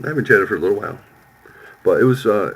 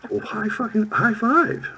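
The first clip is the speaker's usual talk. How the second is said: squeaky mock cheer; 13 semitones higher, slower than usual. Mock celebration.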